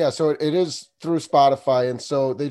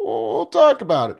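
playful teasing; 8 semitones higher, 4 dB louder than usual. Acted. Teasing repeat of phrase.

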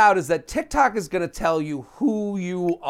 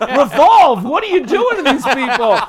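fashionista critic; 7 semitones higher, 9 dB louder than usual. Retold or imitated. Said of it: "where I'm like".

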